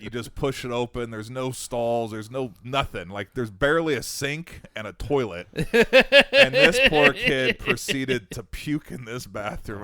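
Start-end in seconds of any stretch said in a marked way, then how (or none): 5.5-9.8 slow, loud, laughing. Real, not acted. Reaching the punchline for friends, laughing slowly. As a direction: drag it out laughing.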